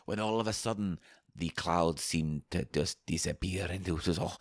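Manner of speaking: hushed spooky storyteller voice; leprechaun voice. Acted. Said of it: "wait, I'm doing a story".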